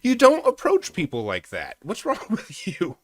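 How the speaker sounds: high, loud disbelief at the story; incredulous outburst. Real, not acted. Streamer reacting to the clip, high incredulous outburst. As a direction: squeal it out, you can't believe he approached her. Reacts to approaching a crazy woman.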